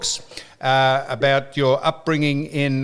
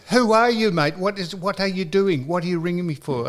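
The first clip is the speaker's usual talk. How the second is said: higher-pitched retold grilling; indignant challenge; 4 semitones higher than usual. Retelling how he questioned the unknown caller, indignant and higher. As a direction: fire questions at an unwanted caller.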